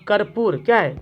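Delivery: normal talking voice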